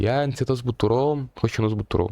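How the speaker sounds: ordinary delivery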